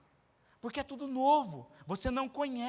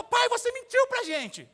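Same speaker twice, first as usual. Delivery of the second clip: put-on high, slow indignant little girl; indignant child; 11 semitones higher, 6 dB louder, slower than usual. Daughter accusing her father, high indignant child voice. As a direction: accuse dad like a betrayed kid. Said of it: "elas ficaram indignadas".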